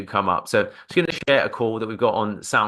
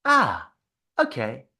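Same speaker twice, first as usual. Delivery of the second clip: filler demo; 2 semitones higher than usual. Shown as a demonstration. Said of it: "something as simple as".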